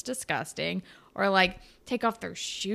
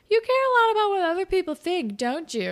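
higher, louder, quick teasing probe; knowing mock Blue; 9 semitones higher, 4 dB louder than usual. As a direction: rattle it off like a smug guess. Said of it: "And Blue is like".

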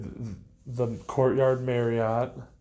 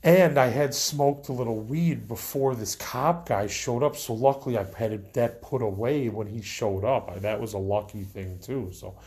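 ordinary talk, nothing marked

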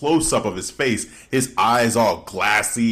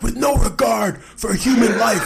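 hyped NBA announcer shout, much higher; announcer call; 10 semitones higher than usual. Retold or imitated. Friend imitating an NBA announcer's dunk call, high excited shout. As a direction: belt it like a play-by-play call. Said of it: "He's like".

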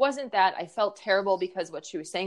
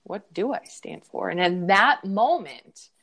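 ordinary talk

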